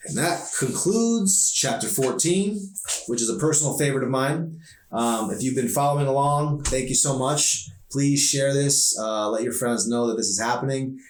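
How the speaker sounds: upbeat sign-off to listeners; chatty outro. Real, not acted. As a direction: thank listeners warmly, brightly.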